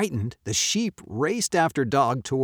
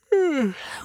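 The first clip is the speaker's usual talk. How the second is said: sleepy sigh. A narrator's reading. Sleepy cat sighs.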